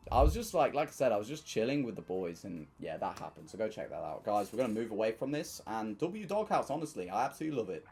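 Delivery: ordinary delivery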